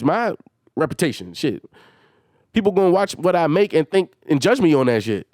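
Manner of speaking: ordinary talk, nothing marked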